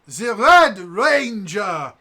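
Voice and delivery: very slow, high, loud proclamation; heroic declaration. Retold or imitated. The professor joining Bulk, slow heroic announcement. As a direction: draw each word out like a proud hero. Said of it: "Professor something comes over and goes".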